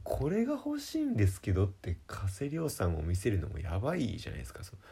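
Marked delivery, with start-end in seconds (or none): 0.0-1.6 high-pitched mock request to the clerk; sheepish request. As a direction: ask shyly, pitched up.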